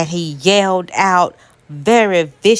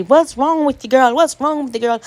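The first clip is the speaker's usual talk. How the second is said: much higher, fussing relatives; worried family voice; 7 semitones higher than usual. Retold or imitated. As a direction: pitch it up like anxious kin crowding her. Family voiced asking what happened.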